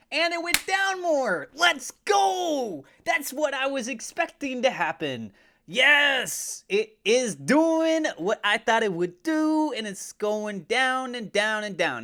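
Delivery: loud, very high celebratory yell; elated shouting. Real, not acted. Host sees the outflow drop as expected, elated shouting. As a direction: shout it in triumph, stretching the key words.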